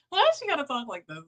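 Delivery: lightly hammed-up imitation of her; mock imitation. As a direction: parody how she talks. Mocking her manner of talk.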